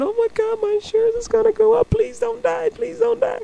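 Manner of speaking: high, rushed inner panic; traumatized panic. Retold or imitated. Traumatized driver thinking of insurance and pleading, mock panic. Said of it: "these drivers just traumatized".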